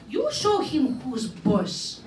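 ordinary talk

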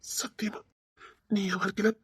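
ordinary talk, nothing marked

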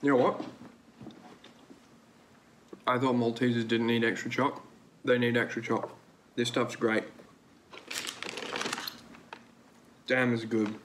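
talking while eating chocolate; muffled chewing speech; mouth full, chewing. Real, not acted. Seen 1: Pursed, working mouth; hand feeds mouth at 4.2 and 5.6.

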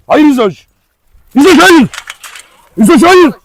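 sharp high shout of no; real alarmed shout to stop a dog lunging. Real, not acted. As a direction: yell the dog off, high and loud. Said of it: "Allah'tan bağırdım ki".

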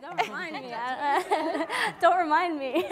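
high, laughing protest, half covering a giggle; laughing embarrassed. Real, not acted. Actress laughing off praise from the host, high embarrassed giggle. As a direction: squeal it out through embarrassed laughter.

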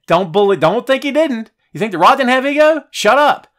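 high-pitched loud outburst; rising into an excited rant; heated rant. Real, not acted.

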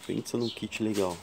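ordinary delivery

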